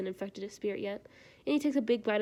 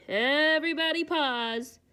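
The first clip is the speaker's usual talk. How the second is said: stretched-out announcement; loud slow call; 6 semitones higher, 5 dB louder, much slower than usual. Real, not acted. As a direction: call everyone to stop. Calling out to viewers.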